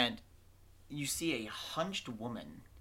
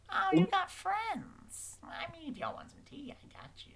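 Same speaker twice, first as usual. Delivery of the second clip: doting witch. Acted. Witch spotting guest's friends in the cart, delighted high coo. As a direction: coo in a high old-lady voice, then trail to hush.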